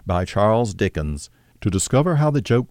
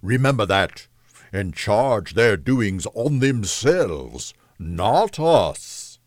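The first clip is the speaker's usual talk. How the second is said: slow, weighty ghostly pronouncement; lightly voiced spirit giving a grave rebuke; solemn reproving spirit; slower than usual. Ghost's speech in the story.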